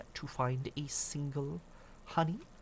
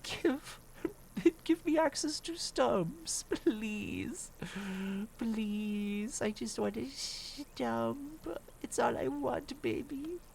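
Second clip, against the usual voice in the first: high, slow pleading whine; whiny begging; 11 semitones higher, much slower than usual. Pretend. Streamer begging the game for tree stumps, high whiny plea. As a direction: beg like a desperate child, voice way up.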